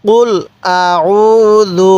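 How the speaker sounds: আউযু drawn out very slowly; long chanted আউযু; slow recitation. Shown as a demonstration. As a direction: stretch আউযু long and even. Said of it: "পড়ুন আমার সাথে".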